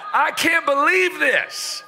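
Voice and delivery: a playful jab at the wife's temper, pitched high and laughing; imitating fuming wife. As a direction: ham up the wife's fury, giggling. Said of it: "Nikki was fuming".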